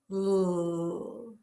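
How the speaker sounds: held "L" with relaxed lips; L sound. Shown as a demonstration. Teacher shows class how lips should be, held L sound. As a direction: keep lips loose and sustain "L". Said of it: "When you make the L sound".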